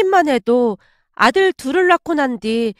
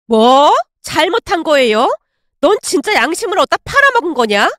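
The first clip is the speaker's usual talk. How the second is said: loud "뭐", then "잘못한 거예요" thrown back in disbelief; outraged mother, quickening into a scolding; indignant echo; 2 semitones higher, 4 dB louder than usual. Acted. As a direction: repeat "잘못한 거예요" as an angry challenge. Angry mother throws her words back.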